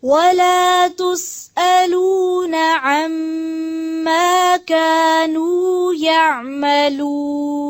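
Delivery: slow, melodic Quranic chanting; Quran recitation. Real, not acted. Teacher recites verses for students to follow, slow melodic chant. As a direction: draw out each verse in tajweed melody.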